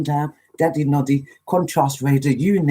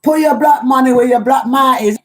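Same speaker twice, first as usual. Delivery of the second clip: higher and louder, a proud signature line; catchphrase; 7 semitones higher, 7 dB louder than usual. Shown as a demonstration. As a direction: punch out your trademark saying. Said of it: "what do I always say?".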